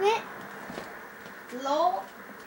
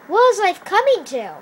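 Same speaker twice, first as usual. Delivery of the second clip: loud, higher exasperated cry; loud despair; 4 semitones higher, 6 dB louder than usual. Acted. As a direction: throw it out in dramatic despair. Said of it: "repeated lament".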